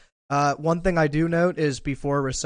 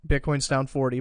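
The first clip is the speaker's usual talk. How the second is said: ordinary delivery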